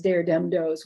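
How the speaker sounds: "there dem does" with hard d sounds. Shown as a demonstration. Lecturer showing Louisiana speech, "there dem does" with d for th. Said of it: "Louisiana, there's".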